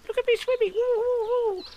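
very high, tiny squeaking voice; squeaky Roo. A narrator's reading. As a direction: squeak it like a proud baby kangaroo. Roo's voice.

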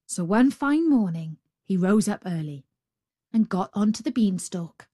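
plain reading voice

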